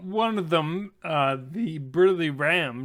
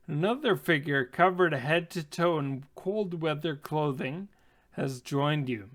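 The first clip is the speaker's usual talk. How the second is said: ordinary talk, nothing marked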